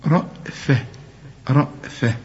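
رأفة with a light, thin hamza and fa; رأفة said softly and thin; thin letters. Shown as a demonstration. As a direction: keep رأفة thin, no heavy ra. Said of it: "رقق الهمزة والفاء".

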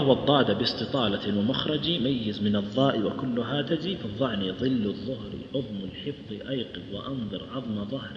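slow chanted recital of the poem; reciting didactic poetry slowly; verse recitation. Shown as a demonstration. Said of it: "يقول رحمه الله".

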